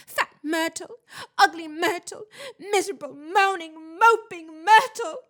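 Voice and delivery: loud, high, building tearful wail; anguished wailing. A narrator's reading. Tearful ghost lists her cruel nicknames, wailing.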